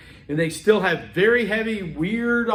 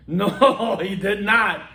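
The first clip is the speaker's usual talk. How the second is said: drawn-out "No", imitating a disbelieving listener; mock disbelief; much slower than usual. Romanian captain disputing the translation, mock disbelief. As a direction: contradict him flatly with a touch of laughter. Acted disbelief in retold quarrel.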